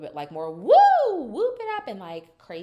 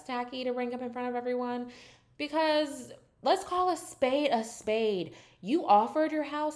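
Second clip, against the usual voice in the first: heated callout; 7 semitones higher, 4 dB louder than usual. Real, not acted. Confronting Angie in retold argument.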